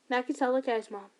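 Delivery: ordinary delivery, nothing marked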